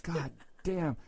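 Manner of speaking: soft, stretched-out disbelief; amazed bystander. Retold or imitated. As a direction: imitate an onlooker marveling under the breath. Imitates a laughing, amazed bystander.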